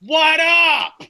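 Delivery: high, loud put-on hype greeting. Host mocking his own race for a laugh, loud put-on greeting. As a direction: shout it in a hammed-up put-on voice. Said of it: "I'm mocking my race for the purpose of comedy".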